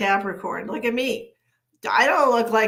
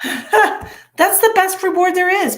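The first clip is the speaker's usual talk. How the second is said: laughing; 11 semitones higher, 9 dB louder, slower than usual. Real, not acted. Laughing high exclamation.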